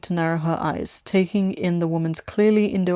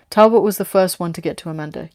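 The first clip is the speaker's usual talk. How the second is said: ordinary delivery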